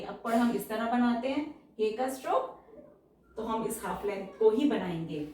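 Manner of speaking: ordinary talk, nothing marked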